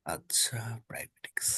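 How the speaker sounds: whispered aside to himself. Real, not acted. Tutor checking his notes privately, whispering. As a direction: breathe the words while scanning the syllabus.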